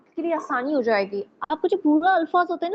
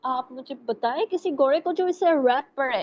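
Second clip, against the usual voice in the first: ordinary talk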